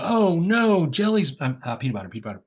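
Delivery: high, loud mock dismay of a shopper. Teacher voicing a shopper upset about prices, high and loud. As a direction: play a shopper upset at the shelf.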